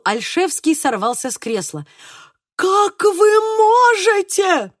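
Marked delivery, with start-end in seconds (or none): 2.6-4.7 slow, high, loud outrage; deeply offended cry. A narrator's reading. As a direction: cry out, mortally wounded, stretching each word. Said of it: "крикнул он со смертельной обидой". (крик смертельной обиды)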